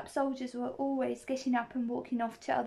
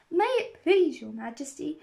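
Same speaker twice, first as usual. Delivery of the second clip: humble, higher-pitched address to royalty; 4 semitones higher, 6 dB louder than usual. A narrator's reading. Gardener humbly addresses the Queen on one knee. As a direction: plead politely, as a lowly servant. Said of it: "in a very humble tone".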